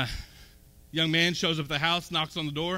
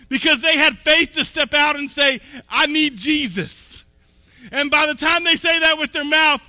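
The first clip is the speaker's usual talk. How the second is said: louder, higher, driving sermon voice; full-force preaching; 5 semitones higher, 5 dB louder than usual. Acted. Pastor urging the church that faith saves, preaching at full force. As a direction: preach it hard to the congregation.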